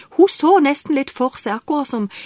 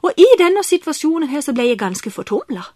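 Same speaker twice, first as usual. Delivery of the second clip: ordinary talk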